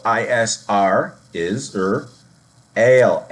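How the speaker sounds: slow, separated syllables, "ale" punched; sounding out Israel. Shown as a demonstration. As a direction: spell I S R, then break it into "is, er, ale". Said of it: "it's not pronounced Israel".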